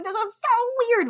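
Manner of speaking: high, stretched-out retold dismay; freaked out. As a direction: relive the weirdness, dragging it out high. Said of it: "I was, like, so freaked out".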